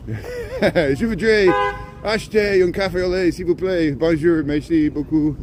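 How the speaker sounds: hammed-up tourist French accent; horrible French. Vlogger showing off his little French to Canadian viewers, in hammy French. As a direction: order a café au lait in bad French. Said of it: "my horrible French".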